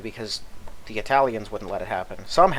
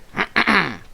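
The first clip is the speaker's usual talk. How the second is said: throat clearing; 9 semitones higher, 5 dB louder than usual. Real, not acted. Throat bothering him while talking.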